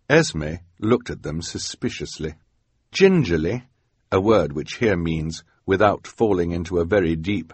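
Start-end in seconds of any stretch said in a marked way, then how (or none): none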